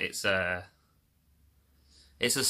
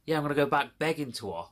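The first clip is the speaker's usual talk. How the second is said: fast, higher, mocking sarcasm; sarcastic; 6 semitones higher, faster than usual. Scoffing at the PayPal agent's suggestion, fast sarcasm. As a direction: scoff at the idea, quickly.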